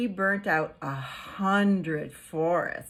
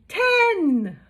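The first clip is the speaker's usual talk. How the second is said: loud, high shout on ten; triumphant final count; big finish; 11 semitones higher, 10 dB louder than usual. Acted. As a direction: burst out the last number.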